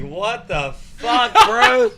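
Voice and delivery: slow, laughing, rising to a high squeal on bro; stunned laughing disbelief. Retold or imitated. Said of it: "And I was like".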